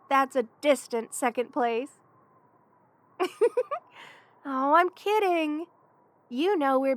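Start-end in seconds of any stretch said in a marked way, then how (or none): 3.2-3.8 high, rising giggle; teasing giggle. Acted. Teasing a best friend about second place, high giggle. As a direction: giggle at your own joke.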